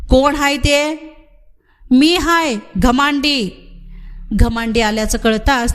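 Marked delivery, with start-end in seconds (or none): 0.1-0.9 called out into the dark, higher; calling out. Acted. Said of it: "सावळा पुढं होऊन ओरडला". 1.9-3.6 calling back. Acted. Answers the call in the dark.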